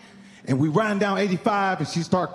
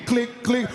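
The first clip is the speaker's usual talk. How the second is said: gun clicks; 4 semitones higher than usual. Shown as a demonstration. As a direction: click out the broken revolver. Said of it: "it skipped like this".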